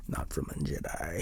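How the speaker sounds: low, slow, quiet villain voice; Emperor Palpatine. Acted. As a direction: drop deep and drag it out softly, like an evil mastermind. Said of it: "Emperor Palpatine in the background".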